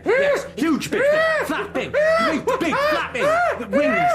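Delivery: shouting charades guesses at the Librarian, mounting panic; frantic charades shouting. Acted. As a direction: build to a near-scream as the picture comes together.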